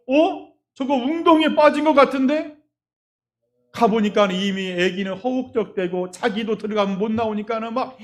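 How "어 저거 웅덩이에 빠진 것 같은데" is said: alarmed surprise. Retold or imitated. Mother spots son drowning.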